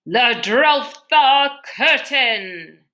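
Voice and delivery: slow, loud, drawn-out proclamation; commanding villain. Acted. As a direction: stretch every syllable like a grand command.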